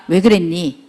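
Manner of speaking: soft, low, gentle question; calm understanding question; gentle asking. Shown as a demonstration. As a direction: ask kindly, without blame. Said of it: "이렇게".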